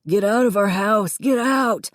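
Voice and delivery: loud, rising scream. A narrator's reading. Davy screaming at Connor to leave, furious. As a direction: scream them out of the house. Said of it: "Davy screamed".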